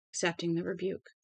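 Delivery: ordinary delivery, nothing marked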